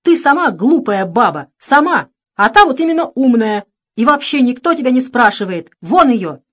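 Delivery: loud, heated tirade; hot-tempered. A narrator's reading. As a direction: lash back in a temper. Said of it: "в запальчивости добавил".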